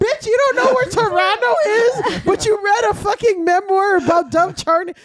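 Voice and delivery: high, loud laughing mock rant; mock outraged rant. Teasing her friend about reading a memoir, a laughing mock rant.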